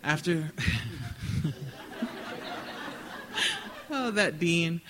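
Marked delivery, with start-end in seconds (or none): 0.6-3.8 quiet airy chuckle; soft breathy laugh. A narrator's reading. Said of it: "Marnie crossed her legs and laughed".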